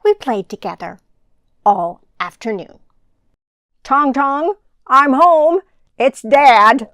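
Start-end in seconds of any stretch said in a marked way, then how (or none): 3.8-6.9 a loud call home to his son; a dad's cheerful louder homecoming call. Acted. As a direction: call through the house so the boy hears. Dad calls out coming home.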